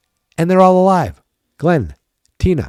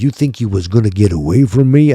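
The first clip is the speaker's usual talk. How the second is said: imitating Freddy with a Southern twang; southern accent Freddy; 5 dB louder than usual. Freddy taunts Nancy in a mock Southern accent. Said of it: "You might as well have had a southern accent there".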